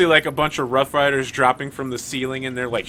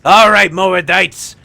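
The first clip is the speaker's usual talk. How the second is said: loud, higher rallying shout; battle cry; 5 semitones higher, 9 dB louder, much slower than usual. Acted. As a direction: yell the troops into a charge. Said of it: "And he's like".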